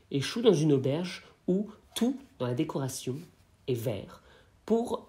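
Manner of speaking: usual speaking voice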